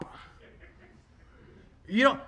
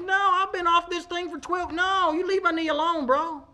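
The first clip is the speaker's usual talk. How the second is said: high, playful refusal; sassy pushback, pitched up; jokingly defiant patient; mock defiant; 9 semitones higher than usual. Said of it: "And I said".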